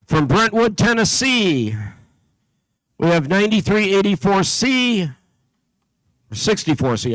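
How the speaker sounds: steady, carrying PA announcer voice; announcer read. Acted. Announcer calling out teams to the competition crowd, steady and carrying. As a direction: call out each town and team number to the hall.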